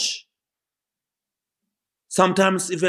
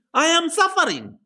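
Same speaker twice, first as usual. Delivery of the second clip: impassioned quote; 13 semitones higher than usual. Acted. Preacher voices Paul's confession.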